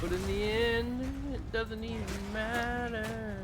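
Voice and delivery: mournful singsong delivery; sad drunk voice. Acted. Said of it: "You sounded like a drunk old sad guy at a bar".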